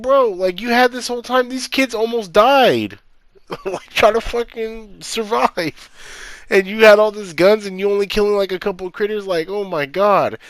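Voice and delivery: high, incredulous disbelief; exasperated rant. Real, not acted. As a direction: pitch up in fed-up disbelief. Ranting at useless aunt character.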